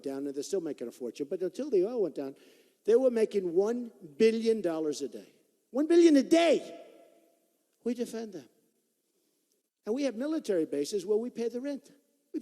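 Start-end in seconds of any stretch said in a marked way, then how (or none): 5.7-6.6 higher, louder cry of disbelief; outraged exclamation. Real, not acted. Telling the rally about Saudi money, outraged shout.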